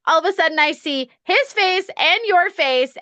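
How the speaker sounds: ordinary talk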